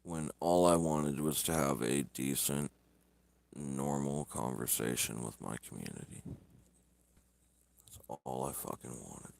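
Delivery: low, soft, defeated venting; dejected. Real, not acted. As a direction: let the hurt sink in, quietly. Streamer vents, hurt by trolls.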